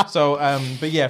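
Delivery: ordinary talk, nothing marked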